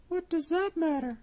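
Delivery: high cat voice, smug; the cat brushing it off; sly cat. Acted. Cat retorts.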